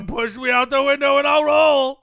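shouted, hammed-up fat guy boast; loud character voice.

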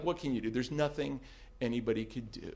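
ordinary talk, nothing marked

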